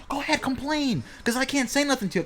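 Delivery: high-pitched, insistent go-ahead; high, emphatic allowance; insistent concession. Real, not acted. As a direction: pitch it up high, granting the point. Concedes the right to complain.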